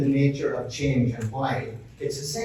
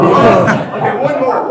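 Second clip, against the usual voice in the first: loud, high, laughing push for one more; laughing call; 7 semitones higher, 9 dB louder, slower than usual. Real, not acted. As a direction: cheer them through the last rep. Urging group through last squats.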